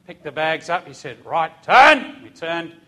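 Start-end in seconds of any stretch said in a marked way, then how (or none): 1.2-2.2 drill command. Retold or imitated. Sergeant orders the squad to turn, barked loud. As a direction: bark the command.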